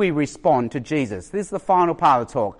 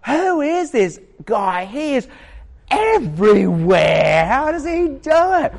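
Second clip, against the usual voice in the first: amazed; 7 semitones higher than usual. Retold or imitated. Teammates puzzled by twin players.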